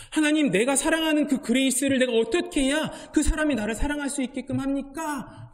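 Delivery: Bruce, stuck in love, asks God for help in a pleading voice; frustrated plea. Retold or imitated. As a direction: beg for an answer, exasperated. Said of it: "이렇게 하나님께 질문합니다".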